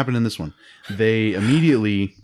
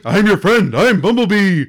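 much higher, louder cartoonish robot voice; mock Bumblebee voice; 10 semitones higher, 7 dB louder than usual. Host mocking Bumblebee's cheesy reveal to Optimus, high cartoon voice. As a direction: overdo a cheesy heartfelt reveal. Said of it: "it was just like".

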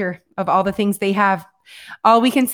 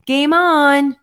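high, punchy, drawn-out "game on"; corrected catchphrase; 6 semitones higher, 4 dB louder than usual. Shown as a demonstration. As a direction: show how the call should really sound. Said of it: "You're saying it wrong".

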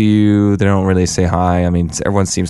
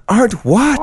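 high, exasperated demand; slower than usual. Retold or imitated. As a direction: pitch way up, asking in disbelief. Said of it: "and I was like".

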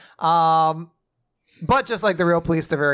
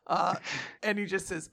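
ordinary delivery

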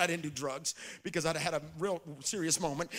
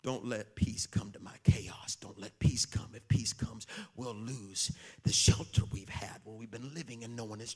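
low, unvoiced demon pleading; 6 semitones lower than usual. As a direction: whisper like a cornered demon. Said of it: "every demon inside of a man started screaming".

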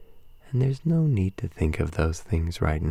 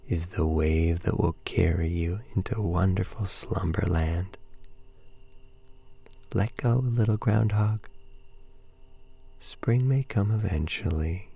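ordinary talk, nothing marked

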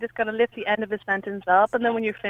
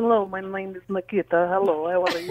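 mock Russian woman introducing herself; fake Russian accent; 3 semitones lower than usual. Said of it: "I tried pretending to be Russian".

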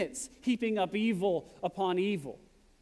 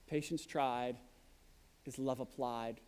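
much softer, lower echo of "Patience tried is love applied"; soft repeat; 7 semitones lower, 8 dB quieter than usual. Shown as a demonstration. Preacher repeating the proverb to the church, hushed and low. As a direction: let the proverb sink in, almost to yourself. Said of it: "It's been said".

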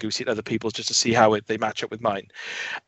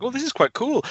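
impressed; 13 semitones higher than usual. Retold or imitated. Retold reaction in the game.

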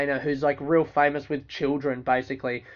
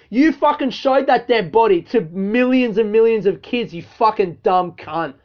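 normal talking voice

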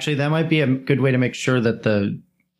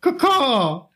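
high squawking caw-caw; crow call. Pretend. Demonstrating crow bird call.

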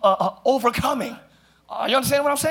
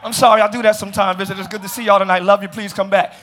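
ordinary talk, nothing marked

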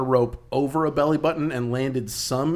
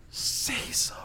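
whispering; 8 dB quieter than usual. Real, not acted. Whispered emphasis.

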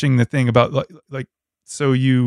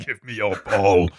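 lightly growled bully demand; a hint of a cartoon villain; slow theatrical villain menace; 3 semitones lower than usual. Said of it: "Jim was the voice, the voice of a villain".

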